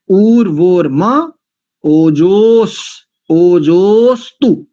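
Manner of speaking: ऊर्वोर्म ओजोस, ओजोस्तु chanted slowly, ojostu fused; syllable by syllable, ओजोस्तु without a gap; teacher modelling ओजोस्तु slowly; slow pronunciation demo. Shown as a demonstration. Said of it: "ऐसा करेंगे".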